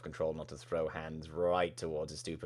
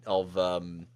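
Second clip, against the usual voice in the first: normal talking voice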